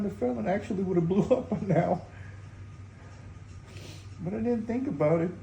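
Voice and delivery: high, wet, tearful voice. Real, not acted. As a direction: still choked up, half laughing. Sad joke while grieving.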